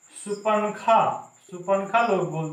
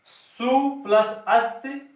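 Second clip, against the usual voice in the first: slow breakdown; 4 semitones higher, slower than usual. Shown as a demonstration. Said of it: "से स्वस्तिक बना है".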